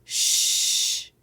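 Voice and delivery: long hissed sh, loud; isolated sound. Shown as a demonstration. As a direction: push out a hissing "Sh" with no voice. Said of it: "What are the sounds?".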